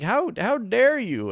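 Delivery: high, slow hammed-up how dare you; mock outrage. Retorting to the women at the bar, mock offended. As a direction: ham up the offense. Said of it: "I was like".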